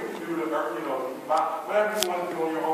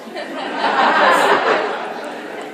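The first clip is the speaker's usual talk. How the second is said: big laugh; 10 semitones higher, 9 dB louder than usual. Real, not acted. Laughing at his own joke.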